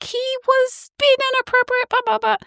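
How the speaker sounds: high-pitched protesting voice; squeaky, huffy complaint; high indignant protest. Retold or imitated. Lily protesting to bouncer.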